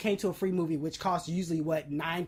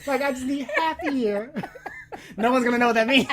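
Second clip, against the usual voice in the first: laughing through the words; giggling while talking; 5 semitones higher, 5 dB louder, faster than usual. Real, not acted. Laughing at own joke.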